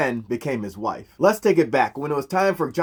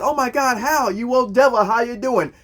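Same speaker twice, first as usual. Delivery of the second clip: much higher, gushing oh my God; mock gushing greeting; 9 semitones higher, 3 dB louder than usual. Katma greets Hal in front of his girlfriend, gushing and high. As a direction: fake delight for show, pitched way up. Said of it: "Katma sees him, says".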